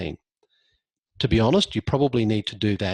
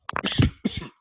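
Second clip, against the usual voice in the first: a dry cough. Real, not acted. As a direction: cough mid-sentence. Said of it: "Sorry, losing my voice".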